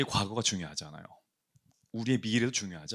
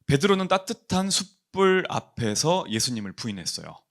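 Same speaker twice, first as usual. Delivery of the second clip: ordinary talk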